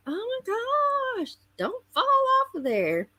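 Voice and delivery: high, slow worried cry; nervous exclamation. Retold or imitated. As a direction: fret as someone teeters on a cliff. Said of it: "and you're like".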